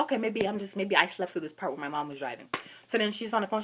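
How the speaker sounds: ordinary talk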